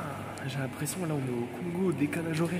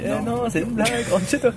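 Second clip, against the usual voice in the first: teasing prank reveal; 7 semitones higher, 5 dB louder than usual. Retold or imitated. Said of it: "je lui aurais dit".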